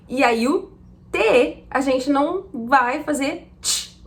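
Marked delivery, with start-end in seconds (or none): none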